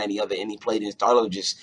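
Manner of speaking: ordinary talk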